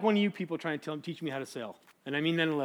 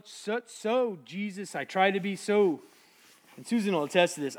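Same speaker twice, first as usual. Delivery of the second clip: normal talking voice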